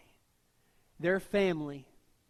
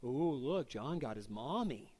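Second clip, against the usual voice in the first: mock taunt; 4 semitones lower, 4 dB quieter, slower than usual. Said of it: "they quarrel over this".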